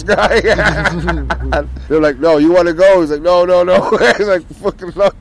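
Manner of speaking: high, loud voice breaking into laughter; laughing while talking. Real, not acted. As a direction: tell it loudly through helpless laughter. Cracking up while telling the story.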